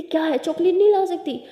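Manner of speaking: exasperated telling-off; scolding. A narrator's reading. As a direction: snap at a partner in irritation. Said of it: "अच्छा डांट क्यों रही हो".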